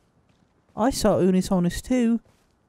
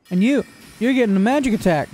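ordinary delivery

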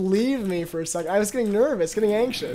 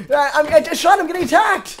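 alarmed shout; 5 semitones higher, 5 dB louder than usual. Real, not acted. Zombie attacking in game.

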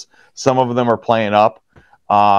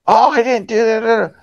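put-on whiny kid; 10 semitones higher than usual. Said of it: "just a whiny little".

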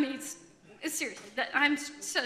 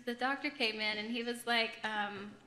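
ordinary talk, nothing marked

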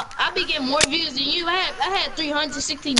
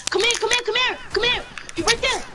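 urgent calling; 6 semitones higher, faster than usual. Real, not acted. Calling teammate in fight.

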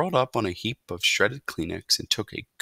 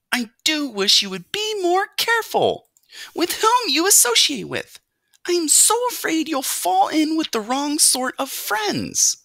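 very high, louder fretting; worried mother mouse; 5 dB louder than usual. A narrator's reading. Mother fears wrong friends for her son, anxious nagging. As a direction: fret and nag in a high-pitched mother voice.

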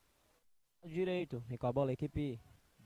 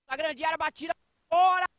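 rising, louder shot call; excited commentary; 10 semitones higher, 11 dB louder than usual. Real, not acted. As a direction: call the near miss with a jolt. Shot goes just wide.

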